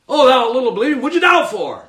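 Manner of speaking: loud, high-pitched exasperated rebuke; mock scolding. Preacher parodying how people imagine Jesus rebuking Peter, mock scolding. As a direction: scold harshly, hammed up. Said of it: "No, I don't think so".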